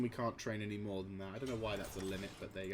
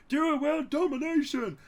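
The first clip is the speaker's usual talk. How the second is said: much higher, louder mock chant; mock commenter shout; 7 dB louder than usual. Imitating commenters demanding world domination, mock shouting. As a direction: yell it like an eager fan. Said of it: "people who've been commentating saying".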